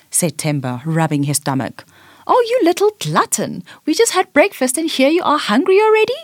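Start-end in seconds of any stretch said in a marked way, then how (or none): 2.3-6.2 high, teasing scold; playful chiding. A narrator's reading. Big sister teasing her hungry little brother, playful chiding. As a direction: tease him like a big sister. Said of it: "chided Tembi playfully".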